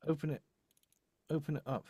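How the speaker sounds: ordinary talk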